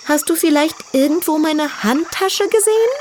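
put-on high, vain young piglet voice; girly piglet voice. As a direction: play a proud little diva pig. Tütü asks.

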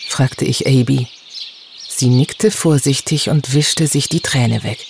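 ordinary delivery

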